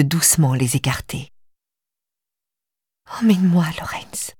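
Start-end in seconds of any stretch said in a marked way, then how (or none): 3.2-4.3 shaky, breathy whisper; trembling whisper. Acted. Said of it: "murmure encore la voix tremblante de Stéphanie".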